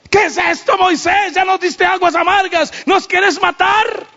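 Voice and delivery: high, shouted complaint of a furious mob; angry crowd. Acted. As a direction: yell at Moses as the angry people. People protest bitter water.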